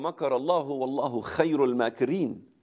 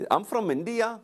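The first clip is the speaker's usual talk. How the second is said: higher-pitched imitation of an Indian accent; lilting mock Indian accent, pitched up; 7 semitones higher than usual. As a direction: mimic a cute Indian accent. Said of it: "like that".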